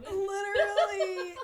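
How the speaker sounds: high, loud laughing word. Real, not acted. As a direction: squeal it while laughing. Laughing at the dead air.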